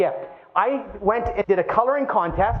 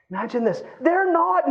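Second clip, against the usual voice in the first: ordinary talk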